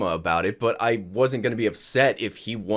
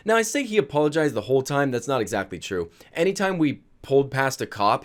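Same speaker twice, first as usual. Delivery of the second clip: ordinary delivery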